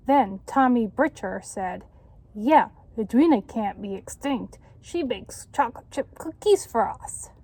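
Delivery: put-on lively read-aloud to children; story narration. A narrator's reading. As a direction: tell it like a picture book. Reads picture book to children.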